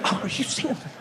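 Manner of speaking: hushed, breath-heavy disbelief. Acted. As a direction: share your disbelief under your breath. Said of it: "then they'll flabbergast together".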